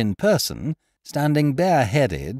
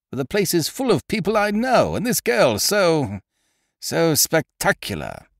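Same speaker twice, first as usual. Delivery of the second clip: fretful protest; 6 semitones higher than usual. Acted.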